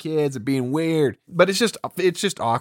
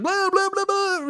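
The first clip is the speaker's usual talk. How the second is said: much higher dismissive blah-blah; mocking blah. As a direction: squeak it out to brush her off. Mocking Erica's rambling.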